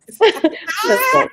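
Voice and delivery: high laughing tease; laughing, playful high-pitched question to a friend. Real, not acted. Host teasing guest, laughing.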